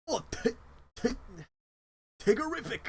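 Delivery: high bouncy Tigger try. Acted. Streamer forced into Tigger voice, high and bouncy.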